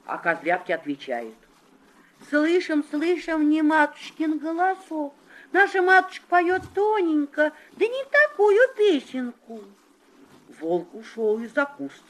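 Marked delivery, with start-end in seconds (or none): 2.3-9.6 high, loud little goats; high child voice. Acted. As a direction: kids shouting high and defiant. Kids answer the wolf.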